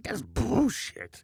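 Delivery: slow, high-pitched mimicry of a Ukrainian accent. Relishing the neighbor's way of saying it, in a Ukrainian accent. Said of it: "I just like the way he says it".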